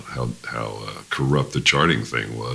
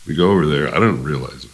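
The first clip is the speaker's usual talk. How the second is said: ordinary talk, nothing marked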